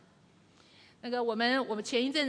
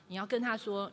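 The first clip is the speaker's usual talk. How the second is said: ordinary talk, nothing marked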